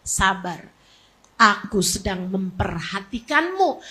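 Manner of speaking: low, soft and measured; gentle solemn reassurance. Acted. God reassuring that He is watching, low and soft. Same command repeated softly, low.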